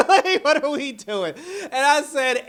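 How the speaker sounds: high squealing laugh through words. Real, not acted. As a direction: crack up while talking. Laughing hard at the scene.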